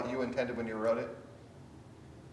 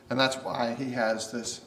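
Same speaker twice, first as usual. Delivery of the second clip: ordinary talk, nothing marked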